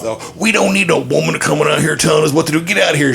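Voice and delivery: sneering golfer shooing her away; mock drunk golfer. Drunk golfers yelling at nurse.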